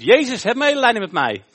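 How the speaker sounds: high, very loud cry for mercy; geschreeuwde smeekbede. Acted. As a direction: shout it out desperately to be heard. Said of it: "Dus hij schreeuwt". (luid schreeuwend om ontferming)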